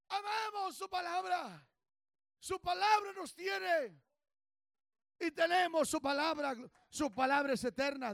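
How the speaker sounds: high, loud and slow preaching shout; fiery preaching. Real, not acted. Preacher exhorts the congregation, slow loud shouting. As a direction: stretch each phrase like a roar from the pulpit.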